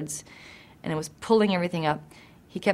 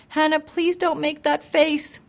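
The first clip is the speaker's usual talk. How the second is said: high, slow pleading sister; sister's plea; 10 semitones higher, 4 dB louder than usual. Retold or imitated. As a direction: plead slowly in a much higher voice. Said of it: "she's like".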